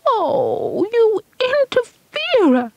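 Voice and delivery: nearly crying over his broken engine; almost in tears. A narrator's reading. Little boy to Ina over his broken engine, close to tears. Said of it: "almost in tears".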